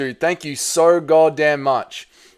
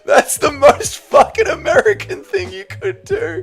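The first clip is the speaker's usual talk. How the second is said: high, slow words squeezed through laughter; real laughing fit, barely getting words out; helpless laughter; slower than usual. Real, not acted. Streamer cracking up at a chat suggestion, helpless laughter.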